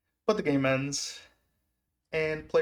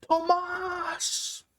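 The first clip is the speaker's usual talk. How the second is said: very high, stretched-out name yell; reenacted calling out. Fan calling to a player running through, high reenacted yell. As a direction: call the name high and long, as if across the stands.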